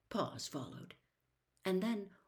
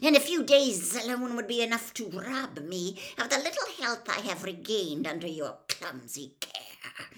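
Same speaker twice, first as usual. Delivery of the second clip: loud, higher, emphatic villain voice; cold angry rebuke; 5 semitones higher, 11 dB louder than usual. Acted. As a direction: rail bitterly at your clumsy servant. Cold master angrily rebukes servant.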